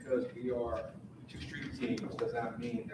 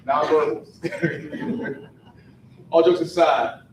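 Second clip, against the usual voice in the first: laughing; 4 semitones higher, 14 dB louder, much slower than usual. Real, not acted. Joking aside, laughing.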